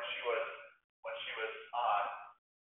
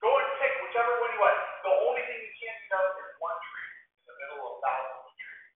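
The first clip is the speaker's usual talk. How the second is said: higher, lively generous God; God speaking to Eve; 7 semitones higher than usual. Acted. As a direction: lightly voice an open-handed, encouraging God. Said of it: "God said to Eve".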